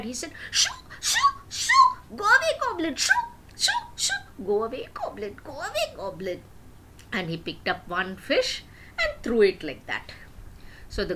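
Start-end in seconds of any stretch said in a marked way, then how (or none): 0.5-6.4 high, frantic shoo with nervous laughs; scared shooing. Acted. Scared fishmonger shooing the goblin from his cart, high and laughing nervously. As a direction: shoo the goblin off in a squeaky panic. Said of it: "got very scared".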